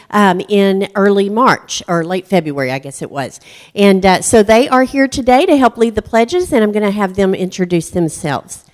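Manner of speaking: ordinary delivery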